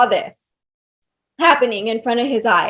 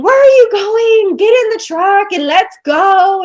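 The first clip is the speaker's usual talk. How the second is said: raised, higher, demanding voice; demanding husband; 7 semitones higher, 5 dB louder than usual. Retold or imitated. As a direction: bark it like an impatient husband ordering her into the truck. Husband yelling at her outside.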